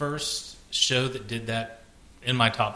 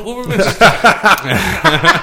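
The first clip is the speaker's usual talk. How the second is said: laughing; 4 semitones higher, 12 dB louder than usual. Real, not acted. Group laughing.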